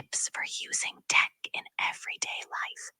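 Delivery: soft unvoiced whisper. AI asked to whisper the rest, whispering. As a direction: whisper it like ASMR. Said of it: "Can you whisper the rest of it?".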